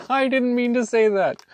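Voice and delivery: high squealing laugh through words. Real, not acted. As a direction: laugh it out, embarrassed. Blurts something, laughs at herself.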